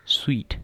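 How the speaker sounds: स्वीट said "sweet", not "suit"; correct pronunciation. Shown as a demonstration. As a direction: glide the w into स्वीट. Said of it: "लेकिन इसको क्या पढ़ेंगे?".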